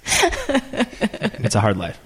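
slow, high, laughing mock lament. Real, not acted. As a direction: laugh through a fake complaint. Joking about notes on mirror.

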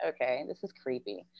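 low, hushed uneasy reaction; wary aside. Retold or imitated. Teacher alone in the hallway, quietly creeped out. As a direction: mutter it low and softly to yourself.

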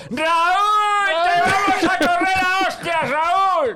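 very high shouted yelling at Raúl; mock angry villagers. Angry villagers shouting at Raúl's new voice. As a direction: chase him out of the village with high yells. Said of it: "tú no puedes volver al pueblo".

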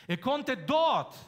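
dort hoch, laut und langsam gesetzt; "dort" kräftig hervorheben; Betonung auf dort. Shown as a demonstration. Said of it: "Betonung auf dort setzen". ("dort" betont)

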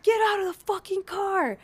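high-pitched mock cop yell; high, insistent mock command; retold yelling. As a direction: imitate police yelling an order, volume held back. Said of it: "they just start yelling".

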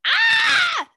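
high, loud delighted shriek; real fangirl scream; excited scream. Real, not acted. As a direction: scream with the thrill of remembering. Delighted at remembering the show's title.

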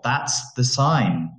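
ordinary delivery

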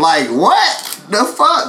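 stunned what-the-fuck; disbelief. Real, not acted. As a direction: pitch jumps up in sudden disbelief. Reacting to officer's remark.

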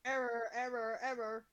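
low, soft robotic error voice; glitching robot. Pretend. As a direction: repeat it like a malfunctioning machine. Call partner drops out.

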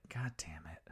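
breathy whispered "God damn it"; whispered curse. Retold or imitated. Retelling, Santa is woken in bed and curses, whispered. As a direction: mutter it under your breath like a grumpy Santa.

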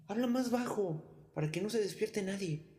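scared hushing. A narrator's reading. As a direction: hush him in a panic. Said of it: "exclamaron los ladrones asustados".

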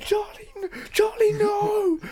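put-on very high, frantic shout for a lost child; panicked mum shouting. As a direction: scream the name like a mum who lost her kid at the airport. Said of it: "spinning round going".

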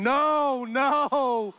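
high, drawn-out mock scream; long high "no", falling away; imitated crowd scream. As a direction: wail it like a horrified crowd. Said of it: "They were screaming".

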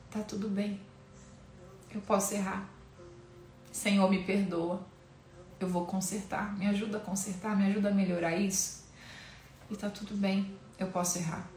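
hushed, gentle self-comfort; a soft, forgiving prayer to herself; soft reassurance. Real, not acted. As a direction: tell yourself quietly it's okay.

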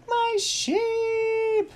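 very high, loud wail; Little Bo Peep. Acted. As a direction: shriek it, drawn out in mock distress. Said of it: "And she's like".

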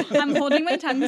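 laughing. Real, not acted. Laughing while talking.

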